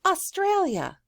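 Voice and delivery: word jumped up high; high sing-song teacher modelling. Shown as a demonstration. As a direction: lift the word up high and bright.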